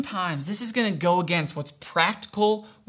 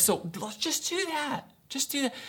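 hushed advising; 7 semitones higher, 8 dB quieter than usual. Acted. Acting someone giving practical advice, softly persuading. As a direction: float the easy option softly and lightly.

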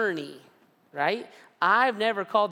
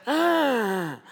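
cry of pain; 6 semitones higher, 5 dB louder than usual. Retold or imitated. Waking in leg pain.

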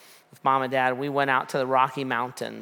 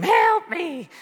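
high-pitched imitation of a scream for help; shrieked mock cry for rescue; panicked scream; 14 semitones higher, 3 dB louder than usual. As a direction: mimic a drowning girl screaming, hammed up. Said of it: "then she'd scream".

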